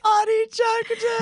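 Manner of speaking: high amazed echo; incredulous squeal. Real, not acted. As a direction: squeal it in disbelief. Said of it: "I literally shoved him".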